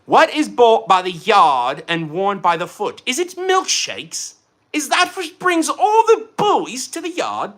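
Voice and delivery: put-on slow British accent climbing high; hammed-up, badly faked British questioning; butchered British accent. Streamer answering a riddle for chat in mock British accent. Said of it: "I'm so butchering this accent".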